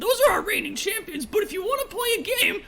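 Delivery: high goblin voice, friendly and eager; a helpful little goblin showing the way; high squeaky goblin explaining; chatty goblin. Acted. GM holds goblin voice.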